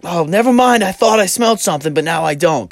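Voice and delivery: high, loud sing-song mom; mocking mom voice. Mom brushing it off to her stoned son, mock high voice. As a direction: play the mom, teasingly high.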